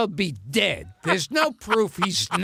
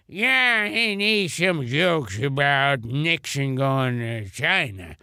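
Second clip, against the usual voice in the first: slurred old comedian impression, dropping lower at the end; Bob Hope; 5 semitones lower than usual. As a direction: run the words together as an aged comic on a late call. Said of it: "he would think nothing of calling someone up".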